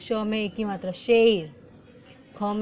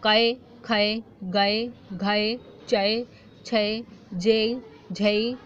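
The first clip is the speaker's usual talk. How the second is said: each syllable stretched out, very slow; slow sounding-out of खै, गै, घै, चै, छै, जे; slow syllable drill; much slower than usual. Shown as a demonstration. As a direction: drag every syllable for the kids to follow. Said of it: "क plus ए".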